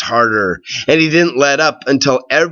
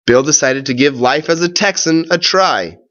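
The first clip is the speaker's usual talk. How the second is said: plain reading voice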